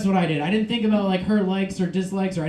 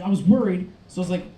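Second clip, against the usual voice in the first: usual speaking voice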